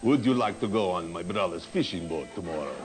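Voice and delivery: low hammed-up romantic come-on; mock Greek lover. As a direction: purr it like a cheesy Greek charmer. Comic imitates Greek lover seducing.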